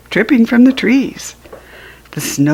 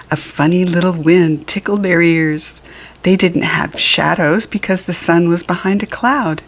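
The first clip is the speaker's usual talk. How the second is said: usual speaking voice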